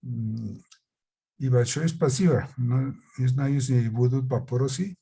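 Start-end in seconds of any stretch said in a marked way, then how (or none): none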